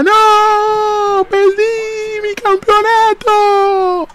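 very high, slow mock wail; mock crying wrestler. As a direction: ham up a crybaby losing his title. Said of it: "estaba llorando". (lamento burlón, muy agudo y lento)

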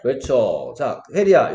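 usual speaking voice

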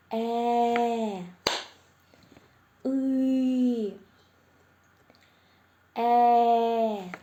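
each vowel held long on a steady level tone; sing-out single vowels, evenly held, for children to catch; sustained vowels. Shown as a demonstration. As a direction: draw each sound out clearly and evenly. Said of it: "Слухаємо уважно".

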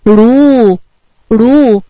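loud, drawn-out "رو" with rounded lips; wind-like letter sound. Shown as a demonstration. Teacher imitating wind to teach damma, long loud "رو". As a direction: hold "رو" as a long "rooo" like wind. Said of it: "ونقلد صوت الهوا وهنقول ايه؟".

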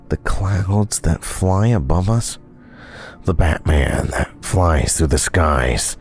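slow, pompous musing voice; mock philosophical monologue. Musing to the audience about Batman, slow mock-profound. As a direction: ponder life like a fake sage.

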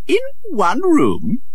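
high, slow incredulous question; be amazed they share one room; a prince hearing of a one-room home; astonished prince. Acted.

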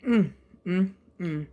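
chewing while humming; talking between bites; mouth full. Real, not acted. Seen 1: Bite at -9; closed, working lips through about 2.8 s.

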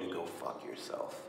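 hissed low, breathy threat; menacing whisper. Acted. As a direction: whisper it like a death sentence. Agent's final threat, hushed.

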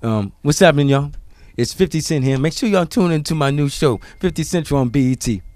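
[name] impression. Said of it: "do your best fifty Cent impression".

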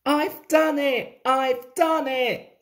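high, elated exclamation; panted triumph. A narrator's reading. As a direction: proudly puff out a victory cry. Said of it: "panted Thomas".

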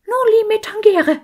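high, loud bawling skull; crâne qui braille. A narrator's reading. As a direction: blare it out far above your own voice, loudly. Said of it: "plärrte der Schädel".

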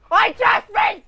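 very high furious yell; outraged shout. Real, not acted. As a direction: scream in disbelief. Hit again.

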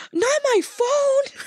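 high, slow, whiny wail; dismayed mom. Retold or imitated. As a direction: drag it out in mock despair.

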